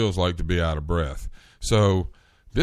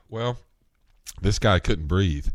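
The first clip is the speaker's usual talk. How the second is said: normal talking voice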